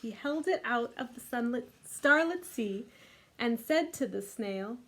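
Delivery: lively storybook read-aloud; reading to children. A narrator's reading. Teacher reading The Snail and the Whale to kids. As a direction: read it warmly to a group of little ones. Reads picture book aloud.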